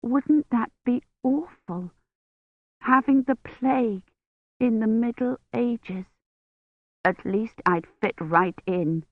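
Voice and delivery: slow, shivering feverish voice; a sick girl forcing words through chattering teeth. A narrator's reading. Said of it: "Her teeth were chattering so hard".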